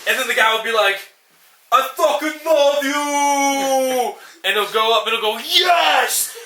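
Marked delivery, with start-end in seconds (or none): 1.7-4.2 very slow, high, drawn-out bellow; movie climax shout. Retold or imitated. Boxer's climactic movie cry. 5.4-6.4 sharp high yelled yes; hammed-up punch shout; mock battle cry.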